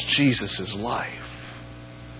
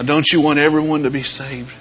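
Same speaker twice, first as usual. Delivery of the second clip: normal talking voice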